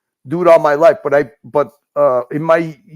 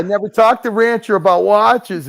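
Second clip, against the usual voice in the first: louder and higher, cutting in to deny it; loud protest; 6 semitones higher, 5 dB louder than usual. Real, not acted. Cutting in on the teasing friend, loud protesting denial. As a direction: insist over the others that you never did. Defending himself against teasing.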